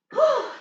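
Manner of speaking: high sharp whew of relief; winded exhale. Real, not acted. Instructor to class after jumps, winded whew. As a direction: puff out hard after the set.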